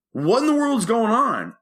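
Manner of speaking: high-pitched incredulous outcry; exasperated outburst. Retold or imitated. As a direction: act out jumping up in disbelief. Jumps out of bed exasperated.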